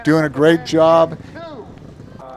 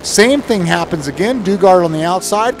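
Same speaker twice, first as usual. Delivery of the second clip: normal talking voice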